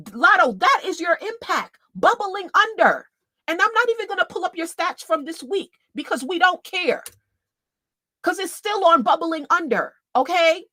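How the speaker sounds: high, mocking taunt. Real, not acted. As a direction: rub it in with a high sneer. Mocking [name]'s chart flop.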